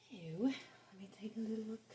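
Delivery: soft unvoiced whisper. Real, not acted. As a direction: whisper it to yourself. Whispering while checking the cards.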